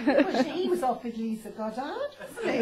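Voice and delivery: loud, slow laughing comeback; laughing retort. Real, not acted. Actress joking with the host about Lisa Goddard, laughing. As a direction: laugh through the words.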